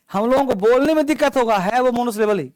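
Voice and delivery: ordinary talk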